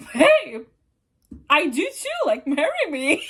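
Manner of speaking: giggling through a cheeky mock proposal; playful laughing tease; laughing flirt. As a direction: laugh while you flirt back. Flirty reply to free offer.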